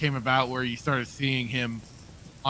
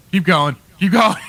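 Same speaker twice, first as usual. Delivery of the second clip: laughing; 5 semitones higher, 9 dB louder than usual. Retold or imitated. Imitating actor covering his slip.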